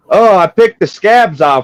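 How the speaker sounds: shouted high and slow; loud slow punchline. Retold or imitated. Hooker answering the john, loud and slow. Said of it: "He's like ... What'd you do?".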